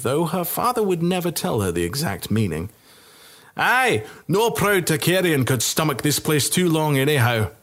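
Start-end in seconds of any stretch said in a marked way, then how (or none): 3.6-7.5 rough drinking-soldier voice, higher; a boozy soldier agreeing; rowdy soldier. Acted. Drunk soldier voice.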